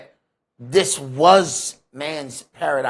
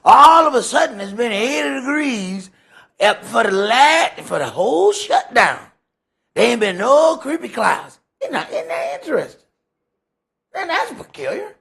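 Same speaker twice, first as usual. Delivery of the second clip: louder, higher preaching burst; raised preacher's cry about the sunny shutdown; fired-up preaching; 7 semitones higher, 7 dB louder than usual. Acted. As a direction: preach it hard to the congregation. Preacher building to full force.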